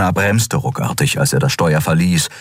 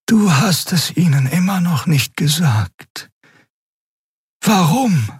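gentle alien woman; 12 semitones higher than usual. A narrator's reading. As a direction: press him gently for an answer. Xea confronts Kero.

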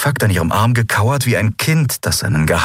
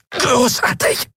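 high, strained, choked cry; strangled high exclamation; slower than usual. A narrator's reading. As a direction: force the word out through pain. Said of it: "Sie wirkte das Wort hervor".

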